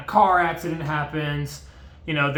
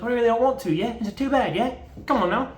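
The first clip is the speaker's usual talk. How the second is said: put-on higher, sing-song British accent; pushy British mate in a hammed-up British accent; mock British accent, each yeah rising; 7 semitones higher, faster than usual. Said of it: "They're all British, by the way".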